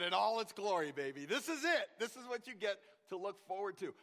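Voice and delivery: high, loud mock triumph; playful teasing. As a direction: tease him grandly. Father teasing son.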